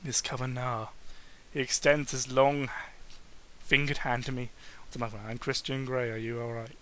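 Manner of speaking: low, soft male voice; softly voiced low businessman. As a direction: drop low and quiet for the CEO.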